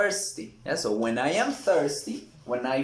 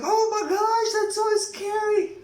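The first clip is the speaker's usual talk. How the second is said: much higher, louder frightened squeal; mock scared; 4 dB louder than usual. Shown as a demonstration. Student watching a horror movie, squealing in mock fright. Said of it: "You are like".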